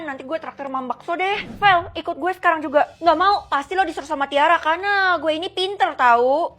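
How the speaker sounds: ordinary talk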